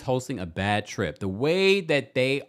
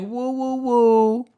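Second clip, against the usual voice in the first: mocking Monique; 9 semitones higher, 4 dB louder, much slower than usual. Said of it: "Now you wanna be like".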